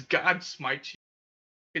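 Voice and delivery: drawn-out, very slow mock smiting; mock holy curse. Roommate hurling the Bible at a soccer player, slow mock curse. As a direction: drag it out like an Old Testament curse.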